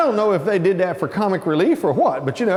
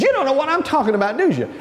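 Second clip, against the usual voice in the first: fast, raised-pitch accusation; imitated challenge; 6 semitones higher, faster than usual. Cellmate realizes the newcomer is bluffing, fast high challenge. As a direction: catch him out, quick and high.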